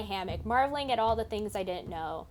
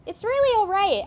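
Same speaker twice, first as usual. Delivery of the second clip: reassuring; 9 semitones higher, 8 dB louder than usual. Acted. Reassuring worried companion, voiced character.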